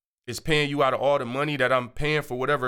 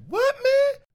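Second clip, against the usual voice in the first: high, loud disbelieving yelp; surprised; 3 dB louder than usual. Retold or imitated. Guest hears no line needed, high shocked yelp. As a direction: squeal in total shock. Stunned at VIP offer.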